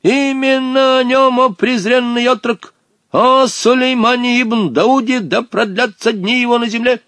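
put-on high, declamatory old genie; negодующий старый джинн. A narrator's reading. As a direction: chant it grandly, indignant at the boy. (возмущённо и торжественно, нараспев)

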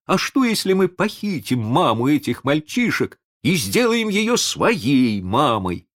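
high, gleeful pirate pitching an idea; bursting with a happy scheme; joyful. A narrator's reading. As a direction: joyfully propose it, pitched well up. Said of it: "Радостный голос неряхи".

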